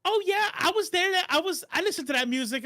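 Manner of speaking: mock wife insisting she was there; imitating wife. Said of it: "She goes".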